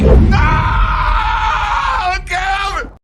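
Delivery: loud, high hype yell of a name; excited shout. Real, not acted. Hyped friend hollering Calvin at the stream clip, loud.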